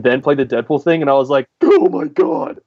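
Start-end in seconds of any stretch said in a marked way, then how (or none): 1.6-2.6 high-pitched awestruck outburst; amazed realization. Retold or imitated. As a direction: relive a mind-blown reaction, pitch leaping up. Reenacts own reaction to trailer.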